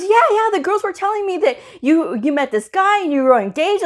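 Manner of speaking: high-pitched, eager retelling; excited gossip. Retold or imitated. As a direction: imitate a coworker gushing over a rumor. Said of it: "this lady was so excited".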